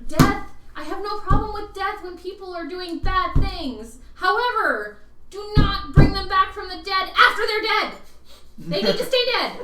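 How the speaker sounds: emphatic. Real, not acted. Heated in-character insistence.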